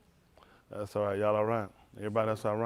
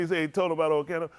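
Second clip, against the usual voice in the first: usual speaking voice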